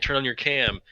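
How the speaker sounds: ordinary delivery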